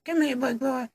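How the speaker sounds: very high falsetto come-on; mock seductive woman.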